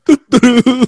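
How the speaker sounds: very high, loud doo-doo-doo through laughter; laughing singalong. Real, not acted. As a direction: echo the tune while cracking up. Laughing echo of the tune.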